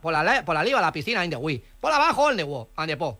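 put-on foreign accent with L for R; foreign-accented broken Spanish; mock foreign accent. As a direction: play a foreigner mangling Spanish, R turned to L. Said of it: "no entiendo yo de".

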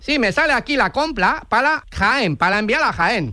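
Spanish in a put-on Chinese accent, Ls for Rs; mock Chinese accent. Fake clerk in China confirming the purchase to a customer, mock Chinese accent. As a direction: play a caricature Chinese clerk.

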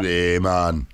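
a relaxed mock Jamaican drawl, lightly done; Jamaican accent. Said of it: "Wish I was off to Jamaica too".

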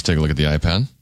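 low, confiding game host aside; hushed password reveal. Acted. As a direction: drop the voice low to tell the home audience the secret word.

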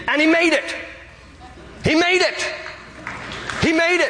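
high-pitched triumphant exclamation; exultant. Real, not acted. Preacher marvels that the thief made it, exultant. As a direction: proclaim it high, with amazed joy.

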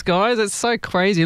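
ordinary talk

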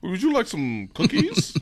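high, slow, overly polite offer; polite server. Pretend. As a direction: play a prim waiter offering cookies. Acting polite cookie server.

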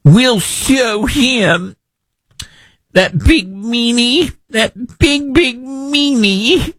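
put-on high, slow, childish taunt; mock a pouting little kid getting even; sneering mock of senators acting tough; mocking whiny senator. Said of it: "sticking it to the man".

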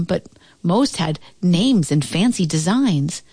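ordinary delivery

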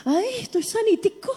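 swooping, amazed voice of the cured cripple; hammed-up marvelling; healed lame man. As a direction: act out delighted disbelief at feeling no pain. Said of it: "cuando ese se dio cuenta, dijo".

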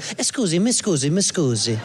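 imitated Italian accent; Italian tourist. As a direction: play a cute Italian tourist asking politely. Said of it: "cute little Italian couple that's like".

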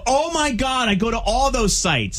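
high-pitched, hammed-up alarm; mock excitement. Prankster confessing sites to scammer, mock excitement. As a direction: get frantically worked up for show. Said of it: "the dog hears my excitement".

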